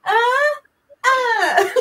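high loud echoing cat cry; high loud mock meow; cat yowl. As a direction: yowl like a cat testing the echo. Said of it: "Just like".

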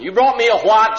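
loud, high outraged question; imitating the wife's indignant shock; shocked disbelief. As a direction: cannot believe what she just heard. Wife shocked at another husband.

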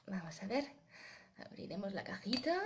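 plain reading voice

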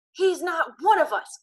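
high, loud frantic shout. Acted. Ben shouts at Albie that Thomas is an outsider, high shout. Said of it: "Ben shouted".

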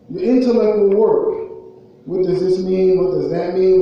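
ordinary delivery

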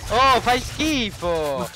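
loud drawn-out high insult; explosive italian cursing. Real, not acted. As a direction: blurt it out loud and slow, disgusted. Friend insults during game.

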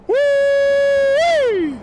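very high, loud whoop. Real, not acted. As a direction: let out a joyful whoop. Celebrating at summit.

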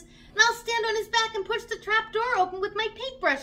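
put-on high-pitched eager young bear; eager little bear. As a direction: pipe up like a keen little cub. Little Bear offers to help.

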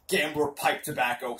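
normal talking voice

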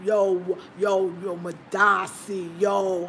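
loud, hammed-up New York yo calls; playful imitation of a Ghanaian with a New York accent; mock Harlem yo. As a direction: shout it like a Harlem guy greeting. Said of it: "I love how he talk like".

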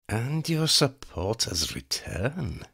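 high, cold voice of a female vampire plotting murder; scheming vampire woman. Acted. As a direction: savour each threat like a predator. Elena answers.

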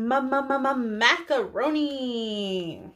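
ordinary talk

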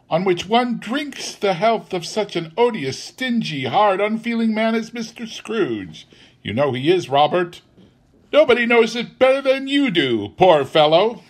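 high-pitched scornful tirade; indignant wife. A narrator's reading. Wife complains to Bob about stingy Scrooge, indignant. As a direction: heap scorn on the employer.

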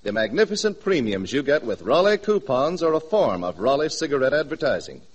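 normal talking voice

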